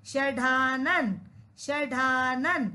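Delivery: षडानन slow, with a long middle vowel; model pronunciation. Shown as a demonstration. Teacher tells children to pronounce it, षडानन slow and careful. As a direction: sound out षडानन slowly, stretching the डा. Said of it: "बोलिए, pronounce कीजिए".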